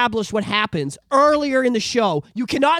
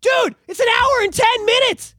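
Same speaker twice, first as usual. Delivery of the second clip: much higher, louder, slow, fed-up yell; exasperated shout; 12 semitones higher, 5 dB louder than usual. Real, not acted. Ranting to the co-host, an exasperated shout. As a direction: blow up over the wasted hour. Ranting about a boring episode.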